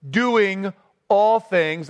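"all" drawn out slow and weighted; stress on all. Shown as a demonstration. Preacher to congregation, slow with "all" stressed. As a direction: lean hard on "all" in "doing all things". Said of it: "that's the force here".

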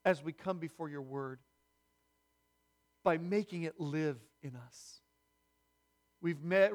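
low, measured prayer with long pauses; congregational prayer. Real, not acted. Pastor praying with the congregation over God's word, low and reverent. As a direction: lead them gently in prayer, unhurried and low.

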